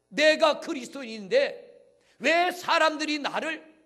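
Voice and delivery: high, booming sermon cry; impassioned pulpit shout; full-force preaching. Real, not acted. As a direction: proclaim it loudly, stretching the key words.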